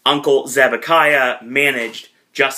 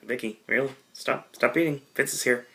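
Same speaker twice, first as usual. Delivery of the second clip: much softer, a quick hushed plea to stop; hushed warning; 3 semitones lower, 10 dB quieter than usual. Retold or imitated. Brad urging Vicky to stop eating before Vince sees, hushed. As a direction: hiss it under his breath like a nervous aide. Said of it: "Brad Maddox is like".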